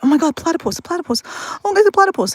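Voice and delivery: very high, giddy mock excitement; acted excitement. Torn away by a platypus sighting, acted giddy excitement. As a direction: get carried away in a high voice.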